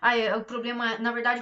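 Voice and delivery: ordinary delivery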